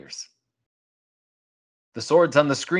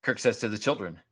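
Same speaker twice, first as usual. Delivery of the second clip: usual speaking voice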